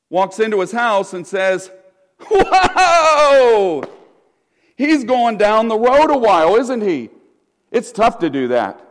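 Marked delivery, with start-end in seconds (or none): 2.3-7.1 high, loud, laughing whoa; laughing mock astonishment; mock surprised whoa. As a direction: exclaim it like a bemused onlooker. Mock exclaiming about long road.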